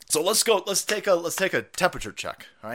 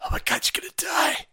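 whisper; 11 semitones higher than usual. Retold or imitated. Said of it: "they keep telling 'em".

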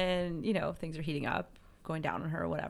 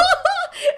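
very high squealing laugh; 14 dB louder than usual. Real, not acted. Laughing at her own panic, very high.

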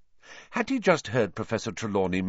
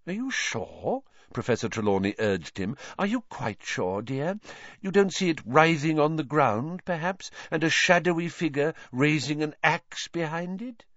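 a hint of a dreamy, eerie seer, coaxing; lightly voiced mystic teacher; 6 semitones higher than usual. Trelawney urging Harry to look closer, lightly voiced seer. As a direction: lean in and lure him to see doom.

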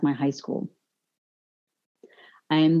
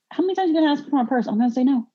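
fast, higher, fed-up snapback; exasperated retort; 8 semitones higher, much faster than usual. Retold or imitated. Teen fires back at teacher asking for purse, fed up. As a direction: rattle it off, sick of being asked.

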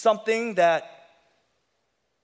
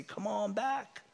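put-on soft, higher coaxing voice of the old master; tempting whisper of sin; 5 semitones higher, 4 dB quieter than usual. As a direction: lure them back softly like a tempter. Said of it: "your old master whispers to you".

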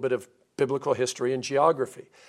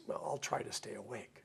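very soft, reluctant mock concession; acted hesitation; 3 semitones lower, 17 dB quieter than usual. Grandson answering his grandfather's pitch, hesitant. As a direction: give in half-heartedly, well under your usual volume. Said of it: "has a lot of hesitation".

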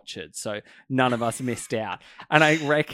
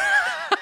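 real squealing laugh, much higher; high burst of laughter; 6 dB louder than usual. Real, not acted. Friend laughs at her story.

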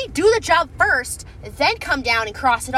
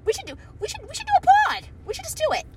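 excited squeals; 14 semitones higher, faster than usual. Real, not acted. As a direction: pitch it up high, thrilled about the idea. Excited plan to do a pod.

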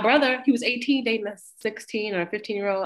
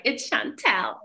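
higher-pitched acted name correction; testy correction; 5 semitones higher, slower than usual. Chantel corrects the man misnaming her, testy. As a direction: correct him pointedly.

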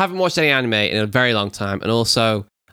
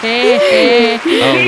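excited shout; 12 semitones higher, 8 dB louder than usual. Real, not acted. Cheery shout after the intro.

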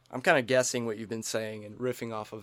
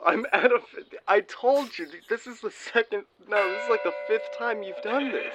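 higher and put-upon, mid-funeral; exasperated friend; 9 semitones higher, 6 dB louder than usual. Acted. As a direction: hiss back in disbelief. Gib annoyed at late call.

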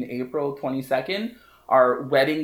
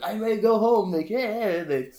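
frustrated whine; 5 semitones higher than usual. Retold or imitated. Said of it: "all frustrated".

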